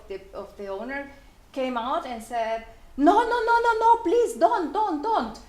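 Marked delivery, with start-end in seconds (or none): 3.0-5.4 high, loud pleading no no no; urgent protest. Retold or imitated. Owner's wife rushing out to stop the biker, loud and alarmed. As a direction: cry out to stop him in alarm.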